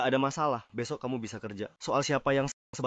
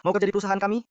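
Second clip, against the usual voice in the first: much higher, louder, taunting question; mocking challenge; 8 semitones higher, 8 dB louder, faster than usual. Assistant taunting the scheming mother, loud mocking question. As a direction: throw it back at her with scorn.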